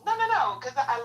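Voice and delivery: excited refusal. Real, not acted. Refusing to block the heckler.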